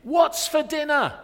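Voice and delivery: high, loud and slow call; husband shouting again to his wife, loud and slow. Retold or imitated. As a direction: call it down the stairs, drawn out. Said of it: "he shouted to his wife again".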